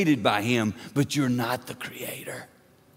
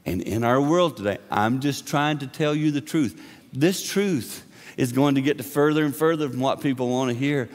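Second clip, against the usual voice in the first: ordinary talk, nothing marked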